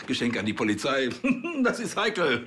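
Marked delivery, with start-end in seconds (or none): 1.6-2.5 chuckling through the words; nervous joking laughter. Acted. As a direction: laugh it off as a delicate matter. Joking about a gift to police. (verlegen lachend)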